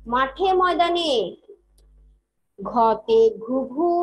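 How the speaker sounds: put-on rhythmic chant, each line repeated for kids to follow; teacher chanting alphabet rhymes, slow and lilting on the first half; sing-song rhyme recital. (ছন্দে ছন্দে ছড়া আবৃত্তি)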